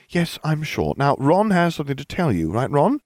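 lightly voiced schoolgirl; Hermione's voice. A narrator's reading. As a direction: prompt him pointedly.

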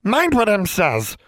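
slow, high, commanding elf; stern high-pitched order; demanding. A narrator's reading. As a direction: demand obedience, drawn out and high. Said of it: "the elf demanded".

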